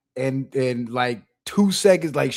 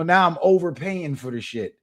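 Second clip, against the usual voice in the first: ordinary talk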